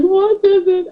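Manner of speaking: high, loud mock-frightened question; retold fright. Wife begging husband to say what is there, alarmed. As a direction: act out urgent alarm.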